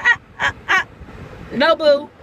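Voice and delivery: loud, high no boo; sassy no. Retold or imitated. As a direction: shut it down sassily. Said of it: "And I said".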